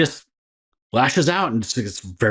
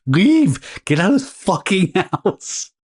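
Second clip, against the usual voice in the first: doctor; 6 semitones higher, slower than usual. Retold or imitated. Doctor voiced in retold story.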